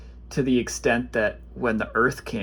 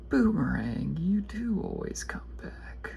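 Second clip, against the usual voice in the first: whisper to the boomerang; 7 semitones higher than usual. Pretend. Addressing the trusty boomerang card, a playful whisper. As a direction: breathe it like a secret to the card.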